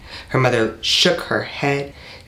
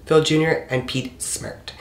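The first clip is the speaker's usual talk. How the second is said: usual speaking voice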